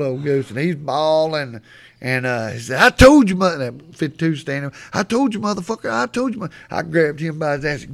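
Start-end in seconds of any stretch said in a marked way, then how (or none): 2.8-3.6 high, loud crowing over an opponent; mock taunting. Retold kid taunting fallen player. 4.9-6.5 high-pitched gloating, not shouted; mock taunting. Retold kid taunting fallen player.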